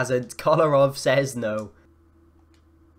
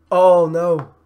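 raised, booming groan of words; loud dismay; 4 semitones higher, 11 dB louder than usual. Real, not acted. Gamer seeing the attack go against him, loud dismay. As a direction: cry out in dismay. Opponent scores.

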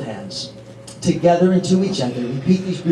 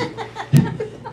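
laugh; 4 dB quieter than usual. Real, not acted. Laughs mid speech.

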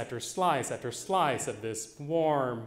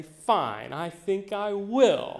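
cocky; 7 semitones higher, 5 dB louder, much slower than usual. Retold or imitated. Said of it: "I'm feeling cocky".

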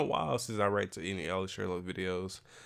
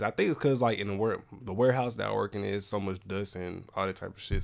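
ordinary delivery

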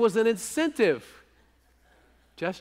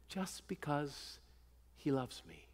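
hushed tender repeat; 4 semitones lower, 13 dB quieter than usual. Shown as a demonstration. Preacher to his flock, repeating softly, hushed and slow. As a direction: drop to a soft, slowed hush on just because he loves me. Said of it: "that he loves me".

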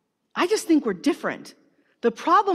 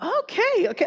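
taken-aback okay; 10 semitones higher than usual. Retold or imitated. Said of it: "I was like".

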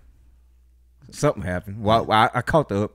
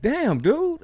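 retold protest. Retold reaction to being bumped.

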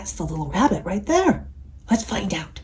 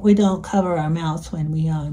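ordinary delivery, nothing marked

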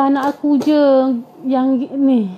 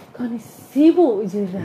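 slow weary grumble; 4 semitones lower, 5 dB quieter, slower than usual. Acted. Jinn grumbling at healer.

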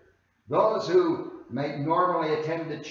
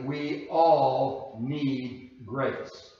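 "we all need grace" drawn out very slowly, word by word; emphatic; much slower than usual. Real, not acted. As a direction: give each word of "we all need grace" its own slow, weighty beat. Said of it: "we can say emphatically".

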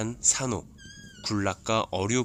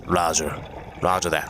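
radio reply; 4 semitones lower than usual. Pretend. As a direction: answer like a soldier on radio.

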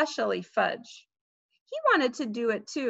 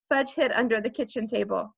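ordinary delivery, nothing marked

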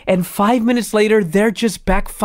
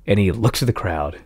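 ordinary delivery

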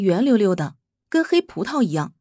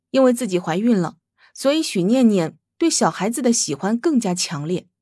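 usual speaking voice

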